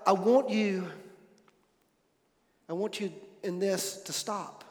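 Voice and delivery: soft, faltering plea with long pauses; quietly imitating a wife's tearful plea; broken voice. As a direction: let the voice nearly give out as you beg him home. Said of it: "in a broken voice".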